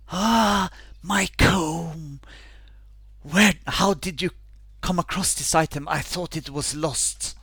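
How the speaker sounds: delighted at finding her comb; excited ghost. Acted. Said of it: "she says".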